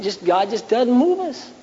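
ordinary delivery

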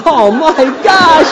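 high, slow, drawn-out dismay; dismayed inner groan. Retold or imitated. Pastor spotted on vacation, slow dismayed groan. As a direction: sigh at being caught.

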